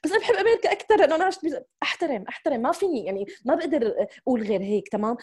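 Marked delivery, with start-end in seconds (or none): 0.0-1.6 put-on a fast, higher and louder kid's voice; imitating her child. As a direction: rattle it off like a child stating the obvious. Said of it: "بتطلع". (تقليد طفل بصوت أعلى وأسرع)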